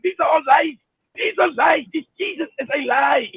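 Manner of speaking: imitating a Zimbabwean accent; lightly done Zimbabwean accent. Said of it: "I love his Zimbabwean accent".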